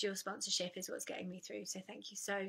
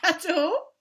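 laughing; 8 semitones higher, 12 dB louder than usual. Real, not acted. Laughing at own joke about sobriety.

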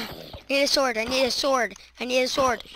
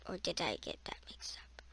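a hushed unvoiced aside to herself; whisper; 9 semitones lower, 28 dB quieter than usual. Real, not acted. Gamer doubting herself alone, whispered. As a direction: whisper the doubt under your breath. Muttering to self while playing.

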